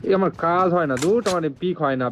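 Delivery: loud, high frustrated outburst; angry. Real, not acted. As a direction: vent real anger at the game. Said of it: "বিশাল রাগ ধরে".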